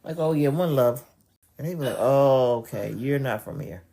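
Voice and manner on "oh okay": ordinary talk, nothing marked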